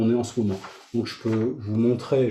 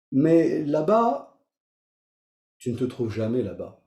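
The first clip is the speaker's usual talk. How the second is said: meditative reading; 3 semitones higher than usual. Acted. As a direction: let each line land, then wait. Reads meditation text aloud.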